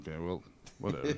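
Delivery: fast, quiet, shrugging it off; dismissive. Retold or imitated. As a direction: brush it off quickly. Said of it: "then I'm like".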